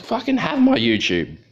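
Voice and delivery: defiant retort. Retold or imitated. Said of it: "And I was like".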